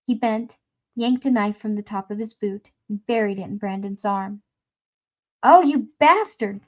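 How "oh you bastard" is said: loud, high, slow cry of pain; pained shout. A narrator's reading. Brandon stabbed in the arm, shouting in pain. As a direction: yell as the knife goes in. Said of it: "shouted in surprise and pain".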